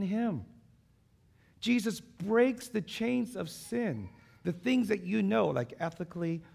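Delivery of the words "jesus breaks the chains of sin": each word laid down slowly, pitch raised; slow emphatic proclamation. Shown as a demonstration. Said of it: "To put it this way".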